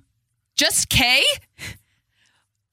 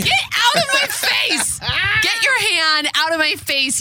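mock outraged yell; 10 semitones higher, slower than usual. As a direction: shriek it in fake outrage. Mock outrage at co-host's hand.

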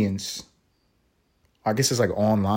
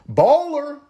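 a much higher, excited shout-out; hype exclamation; 3 dB louder than usual. Acted. Host hypes Elon buying Twitter, excited exclamation. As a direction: hype it like a fan.

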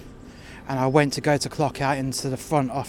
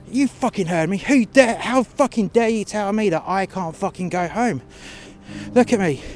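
retold furious rant; 6 semitones higher than usual. Retold furious row with manager.

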